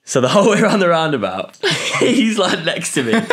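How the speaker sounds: normal talking voice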